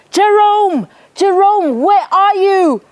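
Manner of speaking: high, loud desperate calling; dad shouting. Acted. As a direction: call out for the lost son, high and loud. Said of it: "he couldn't hear his dad shouting".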